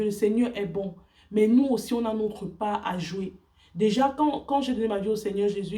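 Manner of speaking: ordinary talk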